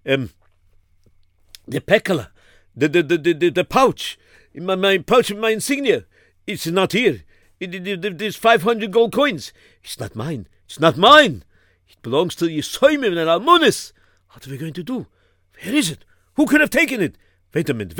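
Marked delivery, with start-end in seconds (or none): 1.7-10.5 put-on high frantic Yiddish accent; panicking old rabbi. Rav frantic over stolen coins. 10.6-11.4 high, loud alarm; alarmed cry. Acted. Second, louder take. 11.9-17.2 put-on high frantic Yiddish accent; panicking old rabbi. Rav frantic over stolen coins.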